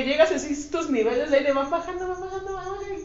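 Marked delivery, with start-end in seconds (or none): 2.1-3.0 running out of air. Shown as a demonstration. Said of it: "Se me va el aire".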